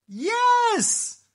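high, loud, gleeful "yes"; delighted witch's cry; excited yes. Acted. Witch welcomes the frog.

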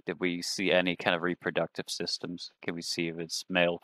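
ordinary talk, nothing marked

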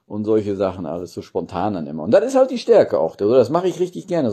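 ordinary talk, nothing marked

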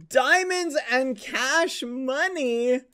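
high, loud and slow, savoring "Diamonds and cash money"; hyped-up loot reveal. Pretend. Streamer showing off a birthday present to chat, in a hyped slow reveal. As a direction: stretch out "Diamonds" like a jackpot announcer.

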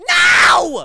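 sudden loud cry of frustration; anguished yell. Real, not acted. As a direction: yell out in real dismay. Losing a snipe, yells.

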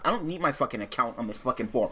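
ordinary delivery

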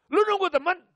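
high, loud demanding father; gruff father voice. Retold or imitated. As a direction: bark the question like a stern dad. Strict father questioning son.

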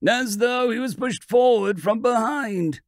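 plain reading voice

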